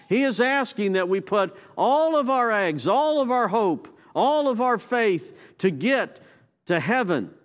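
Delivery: high, loud, fervent preaching; full-force preaching. Acted. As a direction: build the fervor like a pulpit climax.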